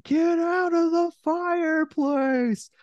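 very high, slow squeaky voice; Nels voice. Retold or imitated. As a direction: stretch it out in a tiny high voice. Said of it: "Nels just like".

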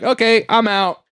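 high-pitched acted-out bail-out; mock panic. Seeing the shit coming and running, mock panic. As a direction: imitate someone fleeing, voice jumping up. John flees as monsters come.

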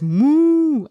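put-on high, loud cow moo for a toddler; playful moo. Mom making the cow's sound for her toddler, high playful moo. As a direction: moo like a picture-book cow.

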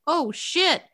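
high-pitched retold surprise; mock shocked realization. Bing's shock at her appearing, acted.

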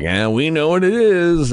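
hammed-up cryptic insider; smug knowing reply. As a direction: drawl it out like you're in on a secret. Chidi's knowing answer to villagers.

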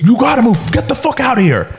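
high-pitched, aggressive mock order; in-your-face bouncer. Bouncer gets in a customer's face, aggressive. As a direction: play the bouncer getting right up in his face. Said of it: "he gets in my face".

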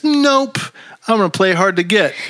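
high-pitched popped Nope; mock breezy refusal. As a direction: start very high, lightly hammed-up refusal. Voicing Blanche's abrupt refusal.

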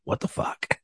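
whispered what the fuck; whispered disbelief. Retold or imitated. Officer whispering to himself when the suspect calls him cool. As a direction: breathe it out in disbelief.

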